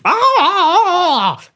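high yelp of horror with a laugh; high scream. Acted. Prime Minister shrieking on finding Lady Gardner. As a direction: shriek at the body.

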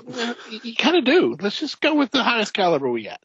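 high, eager trigger-happy voice; playing a grunt who wants the biggest gun; mock gung-ho soldier. Friend mocking the movie's soldiers, high and eager.

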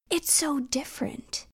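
hushed stage whisper. Real, not acted. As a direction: whisper it like an aside. Aside about the album.

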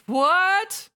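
very high rising exclamation; shocked. Acted. Skuld hearing the player turn villain, shocked high question. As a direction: squawk it in exaggerated shock.

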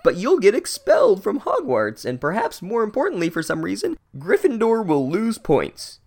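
high, whiny scolding voice; whiny mock worry. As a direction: nag him like a scandalised prefect. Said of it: "they're like".

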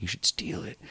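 hushed, voiceless urging; conspiratorial whisper. Retold or imitated. Friend egging on a drunk buddy to steal, conspiratorial whisper. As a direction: whisper it like a secret scheme.

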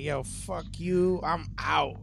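ordinary delivery

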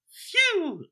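high, drawn-out "phew"; big exaggerated sigh of relief; relieved phew. Macbeth relieved the ghost is gone, exaggerated phew. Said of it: "And then Macbeth goes".

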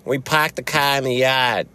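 high, exaggerated Boston accent; mock a Bostonian dropping the R in "car"; hammed-up Boston accent. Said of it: "See, in Boston, they're like".

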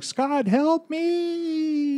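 very high, very slow pleading woman's voice; Jean Grey pleading. Retold or imitated. Jean's illusion begs Scott as she vaporizes: very high, slow plea. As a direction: call out for help in a high, drawn-out plea. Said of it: "as she's like".

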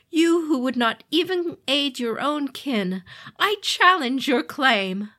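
screaming, high furious fallen god; enraged demon lord. Acted. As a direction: roar the accusation in fury. Fallen god rages at siblings.

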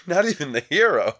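ordinary delivery

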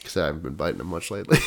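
very low, quick ASMR aside; mock ASMR voice. Podcaster explaining fingernail tapping within ASMR bit, deep voice. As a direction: keep the deep intimate register, moving briskly. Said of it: "This is some A S M R".

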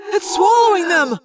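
high, loud cry of alarm; cried out. A narrator's reading. Tobias watching his friends swallowed, horrified high cry. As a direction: cry out in horror. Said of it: "Tobias cried".

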